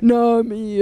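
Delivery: ordinary delivery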